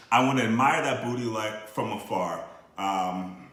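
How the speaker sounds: rhythmic spoken-word reading; poem recital. Acted. Recites his comic poem.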